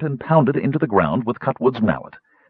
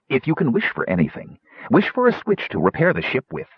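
ordinary delivery, nothing marked

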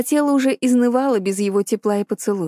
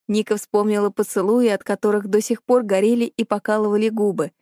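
ordinary delivery, nothing marked

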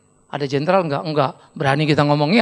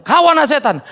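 louder pointed accusation; mock accusation; 5 semitones higher, 5 dB louder than usual. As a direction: call him out loud. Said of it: "Saya bilang". (tuduhan keras pura-pura)

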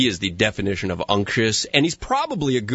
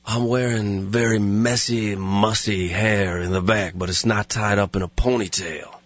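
low, lazy deadpan in character; words rolling out lazily, pitched low; Steven Seagal impression; 4 semitones lower than usual. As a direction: answer with total self-seriousness. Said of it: "your Steven Seagal impersonation".

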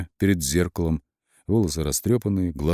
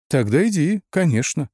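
high-pitched, mildly biting retort; lightly acid, raised-pitch reply; caustic; 8 semitones higher than usual. A narrator's reading. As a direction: send them off with acid in the voice. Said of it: "едко ответил он".

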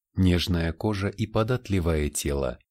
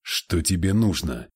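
stunned. Acted. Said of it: "ошеломлённо спросил Фабиан".

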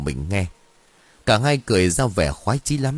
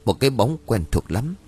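usual speaking voice

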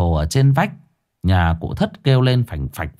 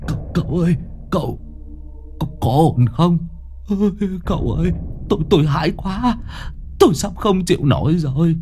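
higher, quavering, near tears; whimpering fear; 7 semitones higher, faster than usual. Acted. Old Thất begging the exorcist, frightened and tearful. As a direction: whimper to the master for help. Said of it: "Ông cụ Thất mếu máo".

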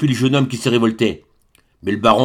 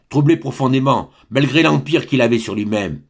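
ordinary delivery, nothing marked

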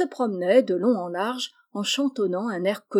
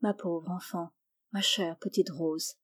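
put-on much softer and lower, a father murmuring to his child; tender murmur; 3 semitones lower, 8 dB quieter than usual. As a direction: murmur it tenderly, barely voiced. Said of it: "en murmurant". (murmure tendre d'un père, beaucoup plus doux)

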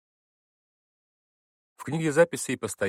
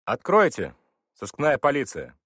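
loud, higher police order; commanding shout; 7 semitones higher, 8 dB louder than usual. A narrator's reading. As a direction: bang out the order like a cop. Police officer demands door open.

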